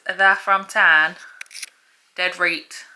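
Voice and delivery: broad Yorkshire, slow and drawn out; a hint of Yorkshire dialect, slowed down; Yorkshire accent. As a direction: read it like a proud Barnsley local. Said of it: "What's that accent?".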